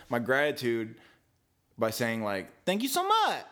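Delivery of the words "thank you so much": high-pitched gushing thank-you; over-the-top thanks. Retold or imitated. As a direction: imitate gratitude that could pass for sarcasm. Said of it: "he took it as sarcasm".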